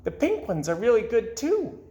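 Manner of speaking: high, smiling fish voice; said with a smile. Acted. Goldfish Gilbert smiles while sharing flakes with the cat. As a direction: offer it kindly with a smile. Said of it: "said with a smile".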